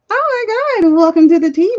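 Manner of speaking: high, loud gushing greeting; delighted loud welcome; excited welcome. Real, not acted. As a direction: squeal a hello to new arrivals. Greeting new viewers excitedly.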